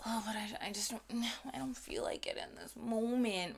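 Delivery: breathy whisper of the excuse; whispered inner voice. Retold or imitated. Whispering the excuse we listen to, quiet. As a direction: whisper it like a tempting thought. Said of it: "The more that we listen to".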